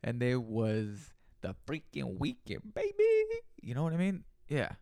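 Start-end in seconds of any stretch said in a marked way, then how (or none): 2.7-3.4 put-on very high stretched baby; squealed baby. Hyping the weekend party to listeners, squealed high baby.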